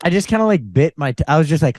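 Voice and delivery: ordinary delivery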